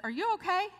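high startled question; stunned, dropping the spoon; high surprised disbelief; shocked. Retold or imitated.

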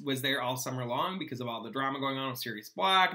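usual speaking voice

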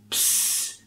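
"Ps" breathed with no voice; voiceless Ps. Shown as a demonstration. Teacher showing the voiceless start of Psyche. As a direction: say "Ps" without any voice. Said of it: "ohne Stimme".

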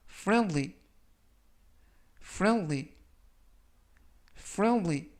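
friendly said slowly and clearly; slow model reading. Shown as a demonstration. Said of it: "제가 천천히 세 번씩 읽어드리고요".

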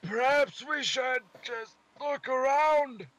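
high, slow strained Scottish voice; Scottish accent. Pretend. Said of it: "I can't do a Scottish accent without straining".

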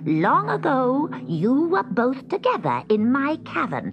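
high, emphatic witch voice; suspicious witch. A narrator's reading. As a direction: interrogate the cat as the truth dawns. Witch works out cat's identity.

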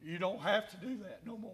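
ordinary delivery

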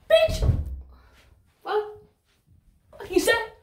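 high-pitched imagined comeback; squeaky mock disbelief at a rude caller; incredulous retort. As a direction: fire back in a high, disbelieving voice.

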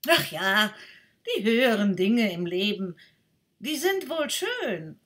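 a breezy, dismissive duck. Acted.

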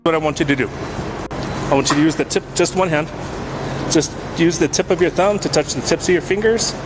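normal talking voice

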